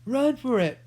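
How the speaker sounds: loud high yell. A narrator's reading. Jemmy to the prince in the dark, loud yell. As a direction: shout it to the prince. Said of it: "Jemmy yelled out".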